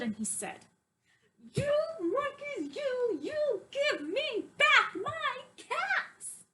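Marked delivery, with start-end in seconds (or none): 1.5-6.4 higher, slow scolding peddler voice; quite angry peddler. Acted. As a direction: scold the monkeys, getting angrier. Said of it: "he felt quite angry".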